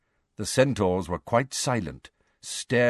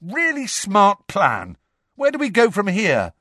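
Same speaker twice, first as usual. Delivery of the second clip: raised, bitter sarcasm; spitting fury; 9 semitones higher, 4 dB louder than usual. A narrator's reading. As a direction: throw it furiously at a friend. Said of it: "release some of his fury".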